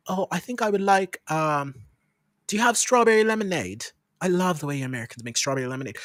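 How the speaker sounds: courteous posh British customer; put-on posh British accent. Flattering an American server over lemonade, posh British accent. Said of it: "this is how I talk every time".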